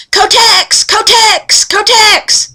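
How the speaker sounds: put-on high, loud child's voice shouting Kotex; mock toddler yelling around the house; squeaky kid cheer, misheard Go Tech; little girl hollering. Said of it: "she was hollering".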